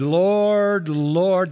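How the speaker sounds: drawn-out, emphatic cry of Lord; loud and high on the first, easing on the second; zealous. Acted. False followers saying it twice for effect, zealous. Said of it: "expressing a measure of zeal".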